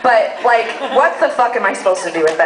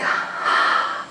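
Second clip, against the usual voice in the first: gasp; 6 dB quieter than usual. Retold or imitated. Gasp of delight.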